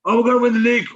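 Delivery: mock fan hype. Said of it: "it's like".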